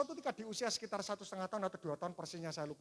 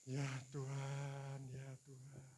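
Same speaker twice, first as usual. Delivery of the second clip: low, slow opening prayer; 7 semitones lower, 7 dB quieter, much slower than usual. Real, not acted. The preacher leads the congregation in prayer, low and slow. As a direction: draw the words out in reverent prayer. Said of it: "Mari kita berdoa".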